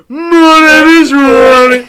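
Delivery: loud, high, drawn-out mock Southampton accent. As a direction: bellow it slowly in a hammed-up Southampton accent. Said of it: "Wanted for saying".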